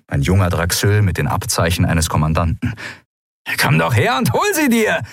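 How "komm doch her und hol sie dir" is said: high-pitched shouted challenge; defiant call. A narrator's reading. Cornered pirate dares the commander, called out high and defiant. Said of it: "rief Lun".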